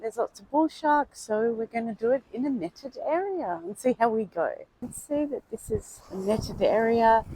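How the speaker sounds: excited beach vlog to camera. Real, not acted. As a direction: talk straight to the camera, upbeat. Recorded vlog clip on beach.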